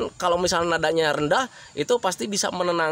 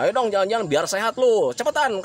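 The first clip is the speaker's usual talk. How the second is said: impatient, raised-pitch nagging; irritating order dressed as an invitation; pushy urging; 4 semitones higher than usual. Shown as a demonstration. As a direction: hurry them along bossily. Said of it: "Berbeda ketika kalian mengajaknya".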